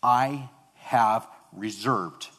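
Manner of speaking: "I have reserved" said very slowly, each word weighed; stress on wording. Shown as a demonstration. Preacher stressing God's words to congregation, slow. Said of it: "Notice the wording here".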